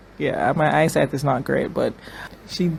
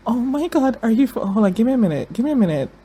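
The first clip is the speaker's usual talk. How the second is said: higher, overwhelmed gasping rush of words; getting emotional; 7 semitones higher, faster than usual. Real, not acted. Viewer stunned by a show twist, emotional and higher. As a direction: catch your breath at the shock, voice pitched up. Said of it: "I'm getting emotional".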